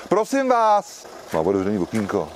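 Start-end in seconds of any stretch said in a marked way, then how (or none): 0.1-1.1 calling out. Real, not acted. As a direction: call out to get the driver's attention. Calling out to a driver.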